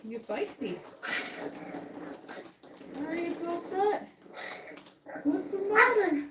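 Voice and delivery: high sing-song voice for a puppy. Real, not acted. Soothing a feisty puppy with high baby talk. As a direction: coo at the upset pup up high.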